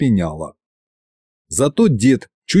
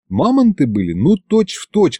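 plain reading voice

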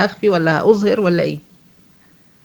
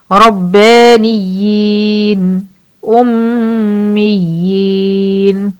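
slow drawn "النبيين" with long final ي; Quranic recitation; much slower than usual. Shown as a demonstration. المعلمة تقرأ كلمة النبيين ببطء. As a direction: recite "النبيين" slowly, holding the madd. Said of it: "جاي لي في كلمة واحدة".

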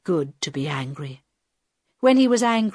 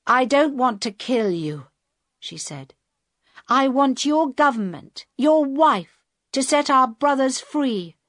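higher, louder, firm demand; hard girl hijacker; 6 semitones higher, 4 dB louder than usual. Acted. Girl hijacker tells Carl what she wants from his wife, firm and loud. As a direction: lay down her terms.

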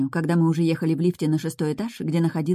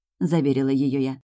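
usual speaking voice

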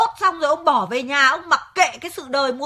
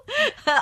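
laugh; 11 semitones higher than usual. Real, not acted. Wife amused during argument.

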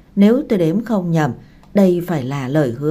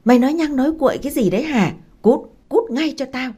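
higher-pitched, rapid-fire angry scolding; narrated angry outburst, pitched up; furious screech; 7 semitones higher, faster than usual. A narrator's reading. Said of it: "rít lên".

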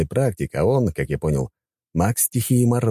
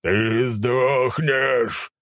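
very slow monstrous growl; dragon roar; 6 semitones higher, much slower than usual. Acted. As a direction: drag out the threat like a beast. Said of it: "прорычал монстр".

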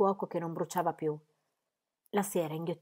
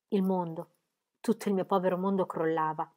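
usual speaking voice

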